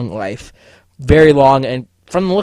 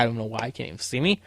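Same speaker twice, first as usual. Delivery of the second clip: quick mutter under breath; 2 semitones lower, 12 dB quieter, faster than usual. Shown as a demonstration. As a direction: mumble it fast and much softer, half to yourself. Muttered repeat of same line.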